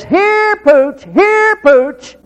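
high, sing-out pet call; acted high-pitched summons to a dog. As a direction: call the dog in from the back porch. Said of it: "he calls the dog that is not there".

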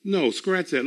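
ordinary talk, nothing marked

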